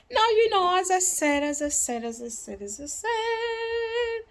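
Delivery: high start stepping down and fading, then a long high held note; playful echo effect. Pretend. Speaker toying with own catchphrase, playful echo. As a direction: repeat it like an echo, stretching the last one.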